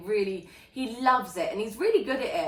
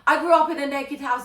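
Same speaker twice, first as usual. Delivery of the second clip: flustered protest; 7 semitones higher, 4 dB louder than usual. Retold or imitated. Flustered explaining retold to art class.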